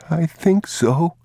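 put-on high, slow, small child's voice; a hesitant boy barely agreeing; unsure little boy. The boy answers his father uncertainly, in a high small voice.

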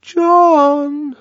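high, drawn-out coaxing call; high, wheedling little voice; small ingratiating voice. A narrator's reading. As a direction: call the name timidly through a door. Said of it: "ventured a small, ingratiating voice".

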